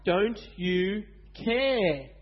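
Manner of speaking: slow, stretched desperate question; drawn-out plea. A narrator's reading. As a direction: drag out the plea to Jesus. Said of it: "Jesus' disciples said to him".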